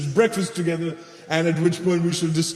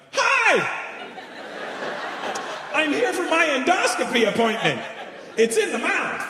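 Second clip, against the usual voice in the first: much higher, eager well-behaved patient voice; polite civilian impression; 12 semitones higher than usual. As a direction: play the nice normal person checking in, pitched way up. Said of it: "I have to do my impression of how I think you would handle the situation".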